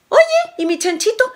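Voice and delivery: abuela aguda, reclamando; voz de abuela. A narrator's reading. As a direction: reclama como abuelita, agudo. (la abuela reclama a Juan, voz aguda de anciana)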